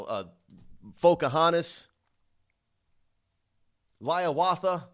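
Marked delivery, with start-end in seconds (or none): none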